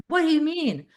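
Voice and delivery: high, loud mock disbelief; baffled. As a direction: blurt it out like you're totally thrown. Retells baffled reply to coach.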